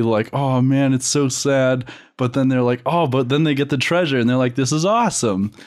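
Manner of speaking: ordinary talk, nothing marked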